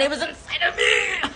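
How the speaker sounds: strained theatrical cry; horrified wail. Real, not acted. Storyteller revealing what really happened, horrified wail. Punchline wailed in horror.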